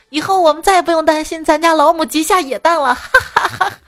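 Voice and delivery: high, loud mock-excited crowing into laughter; excited dad. Dad gloats over child's nest-like hair, excited and high. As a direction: crow with glee, then break into cackles. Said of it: "老爸兴奋的两眼发光".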